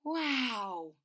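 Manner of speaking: ordinary delivery, nothing marked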